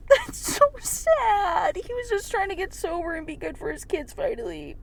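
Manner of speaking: real tearful high-pitched wail; crying. Real, not acted. Reader breaks down over sad passage.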